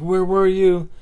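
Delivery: a worried mother's relieved, panicked cry; panicked mom. Retold or imitated. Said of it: "she's just in panic mode".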